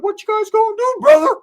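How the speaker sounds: high, loud put-on voice; mock confrontational. Whites sizing up a newcomer, mock confrontational.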